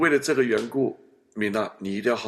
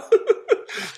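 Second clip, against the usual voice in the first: high bursting laugh; real laugh; 4 dB louder than usual. Real, not acted. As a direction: laugh at your own story. Laughing after retelling.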